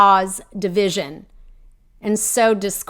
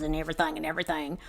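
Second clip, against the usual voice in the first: usual speaking voice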